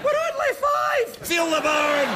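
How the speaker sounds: shouting Scottish drill instructor. Retold or imitated. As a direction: bark at the class like a gym sergeant. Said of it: "If I wanted to be yelled at".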